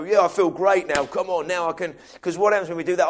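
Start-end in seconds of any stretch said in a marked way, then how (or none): 0.0-2.0 mock self-confidence. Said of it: "rise up in your own strength and go".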